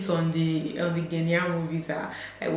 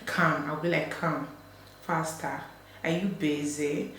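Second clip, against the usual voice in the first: Ghanaian practice; 3 semitones lower, 9 dB quieter than usual. Retold or imitated. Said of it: "practicing it".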